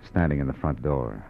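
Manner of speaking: calm detective narration; story narration. A narrator's reading. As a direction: tell it like a weary private eye looking back. Detective narrates over music.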